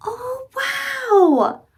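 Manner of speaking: high excited gasp of wonder; delighted surprise. Real, not acted. Praising child's answer.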